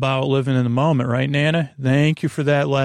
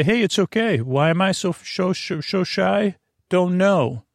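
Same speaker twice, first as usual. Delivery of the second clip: higher, light and teasing; playful; 5 semitones higher than usual. Shown as a demonstration. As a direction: tease it gently, like a friend. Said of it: "or you could say in a playful way".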